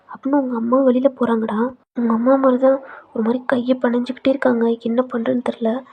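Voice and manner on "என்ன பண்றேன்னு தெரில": plain reading voice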